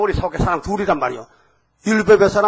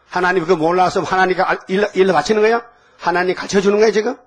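normal talking voice

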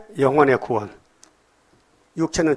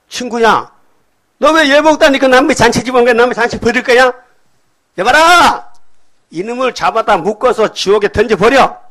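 higher, much louder furious king; roaring tyrant king; 7 semitones higher, 6 dB louder than usual. Acted. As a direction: roar the king's judgment. King condemns guest without robe.

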